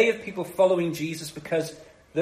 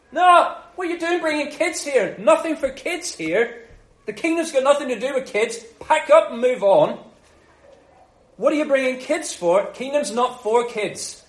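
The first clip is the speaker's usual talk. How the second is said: high, loud, officious shooing; high mock officious scolding; bossy disciples; 5 semitones higher, 4 dB louder than usual. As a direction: play pompous gatekeepers waving families off. Disciples voiced shooing kids away.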